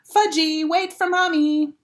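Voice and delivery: put-on high calling after a running toddler; hollering mother. A narrator's reading. Mother running after Fudge, hollering high. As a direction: call after him, chasing.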